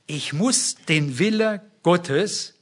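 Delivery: normal talking voice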